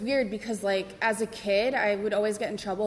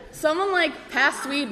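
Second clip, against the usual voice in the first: laughing; 6 semitones higher, 6 dB louder than usual. Real, not acted. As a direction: still laughing, higher and louder. Laughter spills into words.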